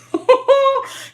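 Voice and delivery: sudden high squealing laugh. Real, not acted. Laughs at the memory.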